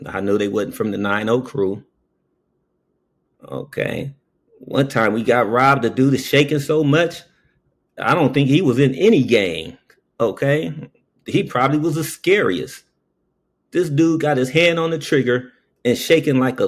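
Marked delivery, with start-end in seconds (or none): none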